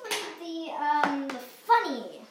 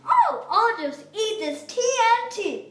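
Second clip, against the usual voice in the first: hungry shark voice; 7 semitones higher, 8 dB louder than usual. Acted. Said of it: "he's just like".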